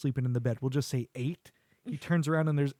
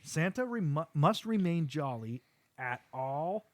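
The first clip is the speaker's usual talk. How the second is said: each word drawn out slowly; slow rule recital; slower than usual. Retold or imitated. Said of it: "rule number two".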